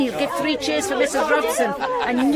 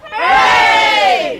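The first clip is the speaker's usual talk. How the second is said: long, loud, drawn-out hooray; children and villagers roaring back a cheer; crowd cheer; 4 semitones higher, 8 dB louder than usual. Acted. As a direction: shout it back with everyone.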